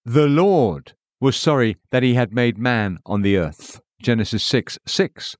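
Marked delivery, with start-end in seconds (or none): 0.1-1.8 "Lord" stretched and punched; stress on LORD. A narrator's reading. Narrator reading a Genesis verse, stress on "Lord". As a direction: open with a long, strong "Lord". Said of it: "emphasis added".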